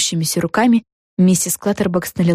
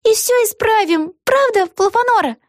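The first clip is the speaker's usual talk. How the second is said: put-on high-pitched girlish character voice; young heroine voice. A narrator's reading. Vega seeking her friend's agreement, high girlish voice. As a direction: pitch it way up, like a little girl.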